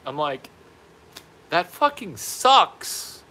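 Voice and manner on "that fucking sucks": high, loud, slow exclamation; hammed-up sympathy; overblown commiseration. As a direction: draw it out loudly with pitch way up. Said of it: "I think I said it like that".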